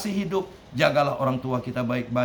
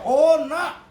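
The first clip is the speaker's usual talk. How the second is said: mother's cry, much higher and louder; raised voice; 13 semitones higher, 7 dB louder than usual. Acted. Old mother on the phone to her son, crying out high and loud. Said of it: "ibunya langsung meninggikan suaranya".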